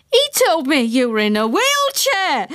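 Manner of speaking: high-pitched accusing yell; shocked, high accusation; indignant shout. A narrator's reading. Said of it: "shouted the woman".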